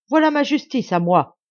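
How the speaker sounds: loud, high-pitched cry; exclaiming. A narrator's reading. Brother brandishes his own justice, defiant shout. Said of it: "s'écria-t-il".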